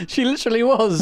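high, laughing disbelief. Real, not acted. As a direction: laugh through it at the silly scene. Laughing at character in bush.

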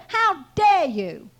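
loud, drawn-out "how dare you"; slow, forceful re-enacted scolding; retold indignant rebuke. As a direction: stretch out the outrage. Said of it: "I could talk to him like that".

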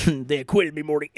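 laughing Rick voice with burps; breaking up while doing Rick; Rick impression. Imitating Rick from Rick and Morty.

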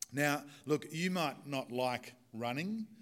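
ordinary talk, nothing marked

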